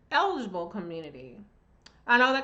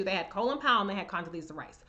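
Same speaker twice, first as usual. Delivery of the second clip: ordinary talk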